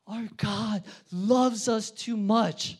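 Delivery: normal talking voice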